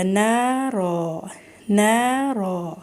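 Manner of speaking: نا را slow with both alifs drawn out equally; pausal long a. Shown as a demonstration. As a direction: stop on نا را, stretching both vowels evenly. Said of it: "اتنا خوبصورت لگے گا". (نا را وقف کے ساتھ دونوں برابر لمبا)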